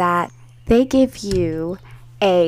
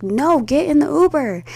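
high, exasperated acted order; insist, pitch pushed up; insistent refusal; 7 semitones higher than usual. Acted quarrel over the Uber.

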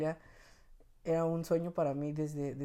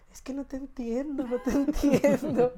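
much higher, whining mock despair, laughing at the end; tired parent whining to the newborn; exasperated plea; 9 semitones higher, 9 dB louder than usual. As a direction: beg the crying baby helplessly. Exhausted parent pleading with baby.

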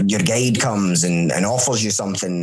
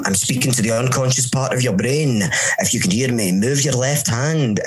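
ordinary delivery

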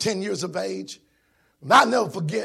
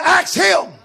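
loud, high shouted preaching; preaching at full force; 10 semitones higher, 9 dB louder than usual. Acted. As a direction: climb to a shout for the church. Preaching climax, shouting to congregation.

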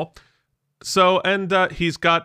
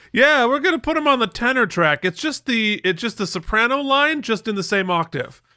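higher-pitched mock teacher voice; imitated choir teacher; 7 semitones higher than usual. Choir teacher excusing a boy soprano, imitated in a higher voice. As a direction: lightly play a teacher making allowances. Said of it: "I think our choir teacher".

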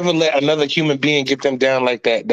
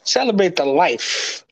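faltering tender eulogy; 3 semitones lower than usual. Pretend. Mock funeral eulogy.

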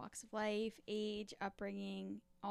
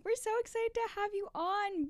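excited gushing; 12 semitones higher, 6 dB louder than usual. Retold or imitated. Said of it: "we say it just like that quite often".

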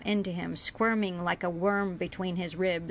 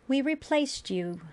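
stating the obvious, pitched up; matter-of-fact; 6 semitones higher than usual. A narrator's reading. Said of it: "she stated, as if it were obvious".

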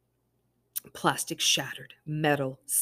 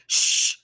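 sharp shush; 9 dB louder than usual. Acted. Mother hushing crying child.

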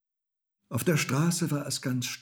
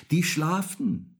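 high, slow, loud answer; cat calling down; 8 semitones higher, 6 dB louder, slower than usual. A narrator's reading. Little cat answers from the tree, calling down. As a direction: draw out the call from above. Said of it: "rief die kleine Katze hinunter".

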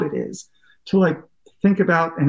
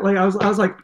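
ordinary talk

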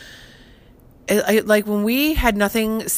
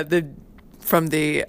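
ordinary talk

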